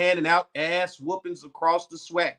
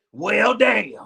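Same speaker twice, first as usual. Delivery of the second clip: a hint of an old gruff commentator; imitating a pundit; 3 semitones higher, 4 dB louder than usual. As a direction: drop it like a seasoned analyst. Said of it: "you sound just like".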